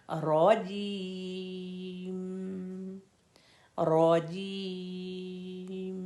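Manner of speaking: रो जीम drawn out very slowly with clear जीम; correct slow reading. Shown as a demonstration. Teacher showing students the right way: slow clear रो जीम. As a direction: stretch रो जीम and keep जीम clear. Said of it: "इस तरह से इसको पढ़ेंगे".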